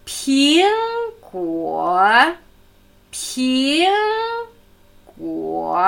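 syllable-by-syllable Ping, Guo with contrasting tones; second and third tone. Shown as a demonstration. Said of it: "zweiter und dritter Ton".

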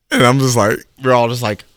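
usual speaking voice

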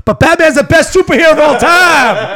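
high, loud Batman outburst; excited shout. Acted. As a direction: yell it like a superfan.

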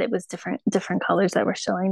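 usual speaking voice

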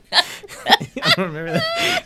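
giggling through words; laughing. Real, not acted. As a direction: laugh while saying it. Laughing after joke.

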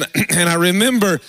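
ordinary talk

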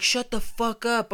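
high-pitched mock yell; imitating an irritated holler; retold heckling yell. Guy in a slide silencing the arguers, yelling. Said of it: "is yelling from inside of a slide".

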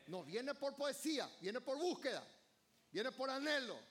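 full-voiced sermon climax; fiery preaching. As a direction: preach it out to the whole church.

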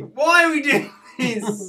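high, loud, laughing show title. Acted. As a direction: sing out the title through a laugh.

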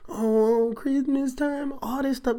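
high, mocking singsong Christmas cheer; mock cheery singsong. Preacher mocking the cheerful holiday crowd, high singsong. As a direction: sing it like the sickly sweet holiday crowd.

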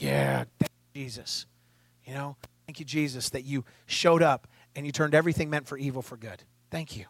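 low, soft murmured prayer; hushed thanks. Retold or imitated. Preacher thanking Jesus for turning evil to good, hushed.

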